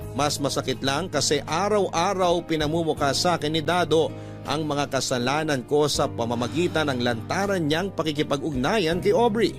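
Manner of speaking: ordinary talk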